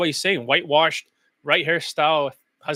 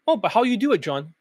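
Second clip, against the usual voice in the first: higher-pitched student voice, dropping off on the name; mock questioning student; 4 semitones higher than usual. Imagined student asks the coach for the method, mock questioning. Mimics a student asking him.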